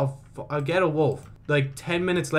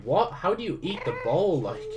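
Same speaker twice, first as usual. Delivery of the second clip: usual speaking voice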